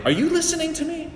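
high, fast mock wife voice; imitating his wife. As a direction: play the annoyed wife, quick and high. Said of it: "And then she'll say".

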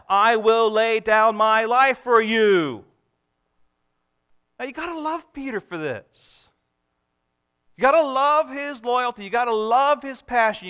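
loud, high, impassioned sermon delivery; preaching at full force. Acted. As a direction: proclaim it to the whole church. Preaching Peter's loyalty, building.

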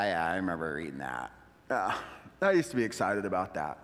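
mock the tired old believer; jaded mumbling believer; bored jaded believer. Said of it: "we're like".

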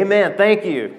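ordinary delivery

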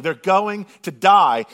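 ordinary delivery

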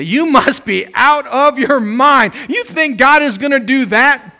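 "You must be out of your mind" high, loud and slow with scorn; mock the bold prayer as crazy; scoffing doubters. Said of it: "They say".

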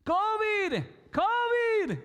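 high, loud mock warning cry; playful mock scream of warning; pretend scream. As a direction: yell it like a mock alarm. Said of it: "Imagine someone with covid screaming".

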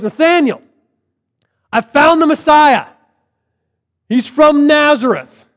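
high, loud, eager announcement; excited calling. Retold or imitated. Philip runs to tell his friend the news, calling excitedly. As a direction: burst in with big news.

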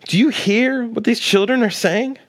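mildly indignant question, pitched high. Retold or imitated. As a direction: challenge him as an affronted religious elder. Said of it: "It says they're indignant".

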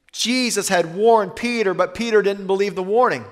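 usual speaking voice

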